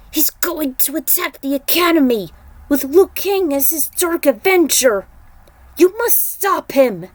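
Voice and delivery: urgent warning. Acted. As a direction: warn them with desperate force.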